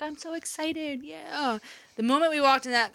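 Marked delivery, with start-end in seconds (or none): 0.0-1.6 soft, slow little-boy voice, only lightly put on; imitating excited son. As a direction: play the small boy gently, not overdone. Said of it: "He's like".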